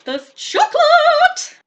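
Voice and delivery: high, loud, drawn-out squeal of delight. Real, not acted. Finding chocolate in her subscription box, a high drawn-out squeal. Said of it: "I get a good twenty-five percent squealia".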